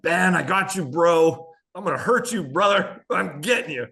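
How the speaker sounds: pumped taunt mid-race; hyped-up trash talk. Retold or imitated. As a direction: psych yourself up and taunt him, bro-style. Trash talk to rival while racing.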